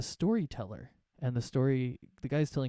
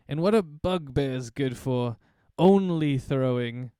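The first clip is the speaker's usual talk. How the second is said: slow, louder teasing jab; mocking retort; 7 dB louder, slower than usual. Shopkeeper mocks the bugbear, slow and louder. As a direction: drag out the insult slowly. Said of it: "He's like".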